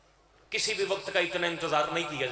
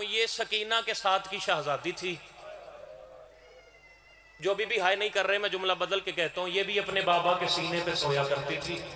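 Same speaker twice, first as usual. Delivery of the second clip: majlis recitation; 4 dB quieter than usual. Acted. Reciter opening the majlis to mourners, solemn and measured. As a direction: recite the elegy with heavy pauses.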